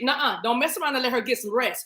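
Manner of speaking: ordinary talk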